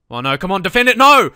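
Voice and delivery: rising yell of dismay at a conceded goal; shouting at the defense. Real, not acted. Gamer yelling at his defenders, panicked shout. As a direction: shout the defense down as they fail.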